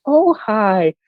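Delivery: high, sugary sweet Midwestern mom voice; sweet Wisconsin mom. Retold or imitated. Son imagines his sweet mom chatting with podcast guests, high sugary voice. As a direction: coo it like a sweet small-town Wisconsin mother. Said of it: "the sweetest, like, Wisconsin woman".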